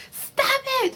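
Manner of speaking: high shrill pleading stop; dramatic mom. Retold or imitated. Kenya pulling drunk Latoya away, high pleading. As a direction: beg her to stop like a frantic mom. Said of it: "trying to grab her".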